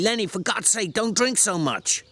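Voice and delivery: high, sharp scolding; a sharp rebuke from the small man. A narrator's reading. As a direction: scold him sharply to stop. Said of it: "he said sharply".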